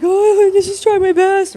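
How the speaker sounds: high, loud, drawn-out wail; mock crying. Crying apprentice to her tall friend, exaggerated high wail. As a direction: imitate yourself bawling, slow and high. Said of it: "and I start crying".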